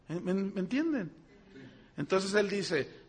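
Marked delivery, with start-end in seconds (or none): none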